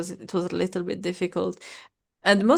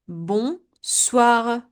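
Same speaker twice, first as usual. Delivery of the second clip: normal talking voice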